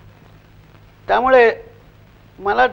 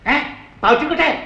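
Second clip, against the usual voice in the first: higher and sharp, snapping at him; snapping clerk; 8 semitones higher than usual. Retold or imitated. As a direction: snap the question like a rude clerk. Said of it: "तो माझ्या अंगावरती खेकसला एकदम".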